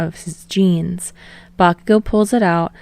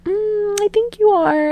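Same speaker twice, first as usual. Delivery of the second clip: much higher playful voice; high mock voice; 13 semitones higher than usual. Reader retelling a favorite quote, high teasing voice. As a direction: tease it in a high voice.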